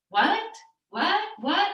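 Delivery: high, rising, repeated disbelief; mock stunned, pitch shooting up; flabbergasted. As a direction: re-enact baffled astonishment in quick high bursts. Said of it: "I was so flabbergasted".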